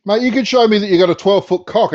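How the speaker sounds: ordinary talk, nothing marked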